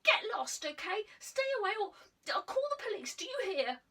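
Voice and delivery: high, loud furious threat; boy threatening to call the police, furious; angry shouting. Acted. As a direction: shout her away with threats. Boy shouting threats while leaving.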